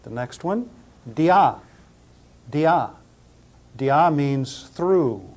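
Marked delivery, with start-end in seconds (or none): 1.1-2.9 "dia" said dee-AH, firm on the final vowel; pronunciation demo. Shown as a demonstration. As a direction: model "dia" slowly for learners as dee-AH. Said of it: "pronounce them all again".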